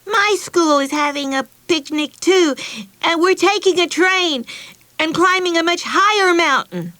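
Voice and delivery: high, loud defiant boast; emphatic. Acted. Betsy outdoes Meg's picnic news, emphatic. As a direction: lean hard on every claim. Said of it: "said emphatically".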